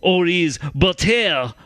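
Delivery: hammed-up slow French accent. Host quoting a French Canadian researcher's tweet, exaggerated French accent. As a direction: read the quote in a put-on French accent. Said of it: "my French accent that I perfected".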